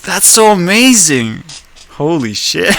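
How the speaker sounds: amazed. Real, not acted. Amazed by character not crying.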